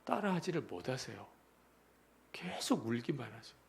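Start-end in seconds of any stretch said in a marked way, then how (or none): none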